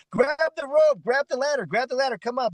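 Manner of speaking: high pitched call up from a helicopter. Retold or imitated. Rescuers call down to the drowning man, high urgent call.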